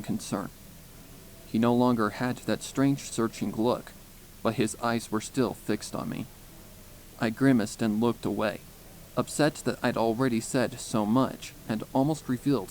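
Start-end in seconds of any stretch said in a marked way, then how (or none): none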